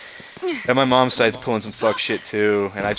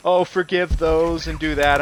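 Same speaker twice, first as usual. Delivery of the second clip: high, louder, slow mock sermon; preachy grandpa imitation; 7 semitones higher, 5 dB louder, slower than usual. Grandchild mocking grandpa's attempt to preach, preachy drawn-out voice. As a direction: mimic a sanctimonious grandparent preaching. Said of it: "Grandpa tried to preach".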